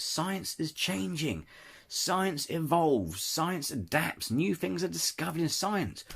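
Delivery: slow emphatic. Real, not acted. Hammering home a point on science.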